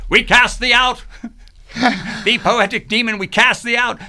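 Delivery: a hammed-up exorcist banishing a demon. An exorcist casts the poetic demon out of the house, loud and high. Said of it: "get an exorcist in the house".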